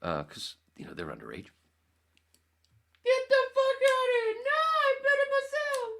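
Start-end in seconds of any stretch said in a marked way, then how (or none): 3.0-5.9 extremely high, loud comic squeal; Bronson Pinchot. Retold or imitated. Quoting Beverly Hills Cop, squealed character impression. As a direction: screech it as a camp movie character. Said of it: "Beverly Hills Cop".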